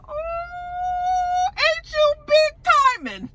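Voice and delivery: high falsetto "oooh", drawn out; mock-impressed. Vlogger ribbing a character for storming out, mock-impressed. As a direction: tease in a squeal-high, stretched-out voice. Mocking a boastful exit.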